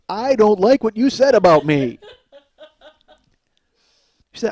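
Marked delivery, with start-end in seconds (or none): 0.1-2.0 loud, higher, emphatic translation; mock indignation. Son translates mom's remark for listeners, loud mock indignation. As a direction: spell out the hurt at full volume. Translates what mother really means.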